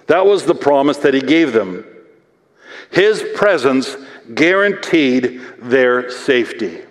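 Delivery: ordinary talk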